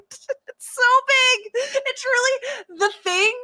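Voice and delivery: high, slow, drawn-out disbelief; astonished. Real, not acted. Recapping the giant needle, slow high amazement. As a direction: stretch it out, gasping at the size.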